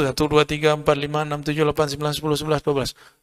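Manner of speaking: rapid counting. Shown as a demonstration. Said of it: "Udah berapa ribu tuh?".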